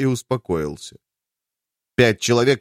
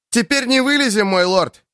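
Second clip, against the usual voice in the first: shouting; 9 semitones higher than usual. Acted. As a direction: yell it over the rain, urgent. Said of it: "крикнул ему на ухо Кит".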